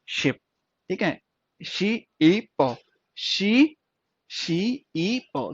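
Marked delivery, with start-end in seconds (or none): 1.7-2.8 शी ई पो said very slowly, each sound drawn out apart. Shown as a demonstration. Teacher sounding out शी ई पो slowly for students. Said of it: "क्या बन गया?". 4.3-5.5 शी ई पो said very slowly, each sound drawn out apart. Shown as a demonstration. Teacher sounding out शी ई पो slowly for students. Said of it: "क्या बन गया?".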